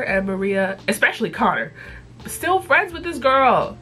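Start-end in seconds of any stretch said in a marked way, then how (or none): 2.3-3.8 high and slow, outraged; indignant. Real, not acted. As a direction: drag it out in disbelief. Venting about a disliked girl.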